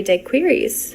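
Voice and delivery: daiquiris said as "dye-queeries"; mispronounced daiquiris. Retold or imitated. Date suggesting drinks while driving around, mispronounced daiquiris. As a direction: read daiquiris as spelled, "dye-KWEER-eez". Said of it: "mispronounces something because they know another language".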